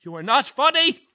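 high, shrill-edged indignant warning; indignant threat. Acted. The lead actress warning the prankster off, indignant. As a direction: put the prankster in his place.

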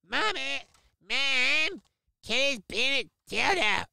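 high whiny cartoon kid calling for mom; Eric Cartman. Streamer tattling on the cat in a Cartman whine. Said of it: "Eric Cartman voice right now".

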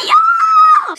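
put-on very high, loud child's yes; little girl squealing yes at dad; gleeful kid shriek. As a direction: squeal it in delight. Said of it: "Jag bara".

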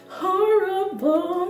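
high, drawn-out comic cry; hammed-up tearful horror, laughing; mock wailing. Telling about humiliating test scores, mock wailing.

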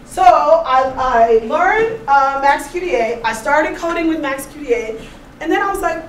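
ordinary delivery

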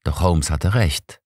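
usual speaking voice

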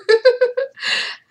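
loud high burst of laughter; real amused laugh. Real, not acted. Laughs at him.

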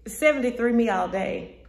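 usual speaking voice

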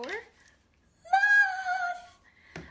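high, loud, long-held cheer; announcing with fanfare. Real, not acted. Streamer revealing who the live is for, cheering it out. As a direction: belt out the brand name and stretch it.

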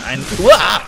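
sudden loud fright cry; real startled shout; startled yell. Real, not acted. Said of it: "Ich hab mich grad richtig erschreckt".

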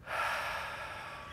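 long audible breath out; meditative exhale. Real, not acted. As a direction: let out a slow, airy sigh.

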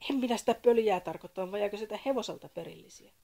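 angry. A narrator's reading. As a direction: snap at him, fed up. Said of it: "Sakari suuttui".

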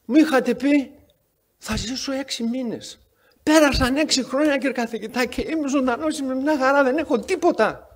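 a hint of an eager man introducing himself; cheerful patient. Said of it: "και του λέει".

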